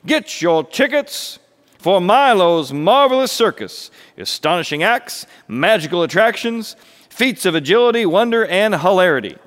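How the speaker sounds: loud, high ringmaster patter; grand, swooping showman's pitch; circus barker. Acted. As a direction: sell the show to the passing crowd. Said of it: "he beckoned".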